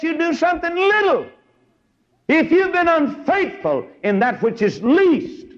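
high, ringing pulpit voice; preaching at full force. Acted.

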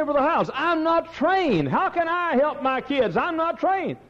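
high, imploring voice of a doubting member; a worried member protests to the pastor; pleading churchgoer. Retold or imitated. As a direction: beg the pastor like an untrained layman. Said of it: "But you say".

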